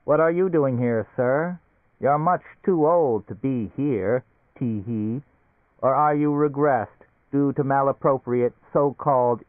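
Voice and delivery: a chirpy gadget voice, slowing now and then; a hint of a prim little machine. A narrator's reading. A talking suitcase's machine voice.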